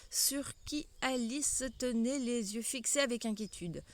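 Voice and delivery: ordinary delivery, nothing marked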